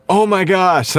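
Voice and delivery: high, hammed-up shock; mock amazement. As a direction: gasp it like a clickbait thumbnail. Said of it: "like one of the crazy thumbnails".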